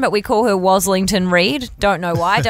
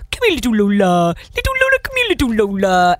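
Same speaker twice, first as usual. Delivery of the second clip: silly pet voice. Retold or imitated. Imitating the boss calling his dog Lola, silly baby-talk voice. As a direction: coo to a little dog, imitating. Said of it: "You put on that silly voice".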